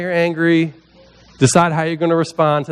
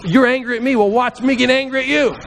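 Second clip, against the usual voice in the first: mock angry retort; 7 semitones higher than usual. Tempted to counter-punch someone angry at him, mock indignant. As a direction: act out throwing a counter punch. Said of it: "throw some counter punches".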